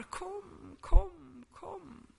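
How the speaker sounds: high, wailing, whimpering kom, rising and breaking; groaning cry. Acted. Preacher voicing the Spirit's groaning cry, wailing. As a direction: let each kom ache like a wordless sigh. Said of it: "en slags kvidande läte".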